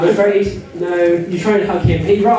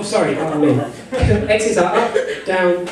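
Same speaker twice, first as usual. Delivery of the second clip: ordinary talk, nothing marked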